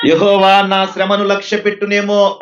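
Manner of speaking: slow pleading read. Shown as a demonstration. Reads David's plea slowly.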